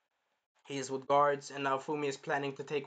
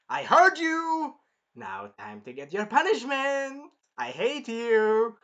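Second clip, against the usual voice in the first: much higher, loud, slow hammed-up yell; ranting king; 7 semitones higher, 7 dB louder, slower than usual. The king yelling punishment at the hero, hammed-up shout. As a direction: bellow like a petty tyrant.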